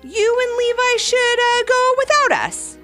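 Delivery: high, awkward suggestion; nervous hesitation. Acted. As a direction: falter mid-sentence while covering up. Mom continues her awkward excuse.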